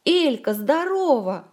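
loud, high hail to a friend; mock shouted greeting. A narrator's reading. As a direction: holler a drawn-out hello, acted. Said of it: "ору".

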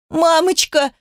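put-on high-pitched desperate little girl; sobbing child's cry. A narrator's reading. Daughter torn from mother, crying out in tears. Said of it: "Дочь захлёбывалась рыданиями".

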